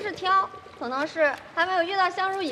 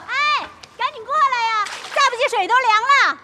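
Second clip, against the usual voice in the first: calling over; 8 semitones higher than usual. Acted. Calling friends over to the water.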